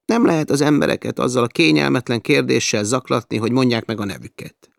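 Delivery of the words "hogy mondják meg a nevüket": plain reading voice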